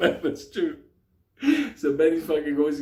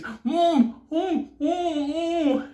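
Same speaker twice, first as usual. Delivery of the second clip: high, slow muffled moaning through tape; muffled gagged moans; 13 semitones higher, much slower than usual. Retold or imitated. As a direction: moan like a man gagged with duct tape.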